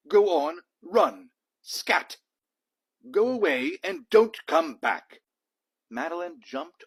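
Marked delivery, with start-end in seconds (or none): 0.1-5.2 much louder, higher shouted chasing-off; shouting lord. Acted. Lord Cuckoo-Face chasing Genevieve out of the school, shouting. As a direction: yell to shoo the dog out. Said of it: "said Lord Cuckoo-Face".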